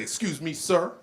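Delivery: lightly voiced officer at a car window; traffic cop. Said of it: "the cops pull you over".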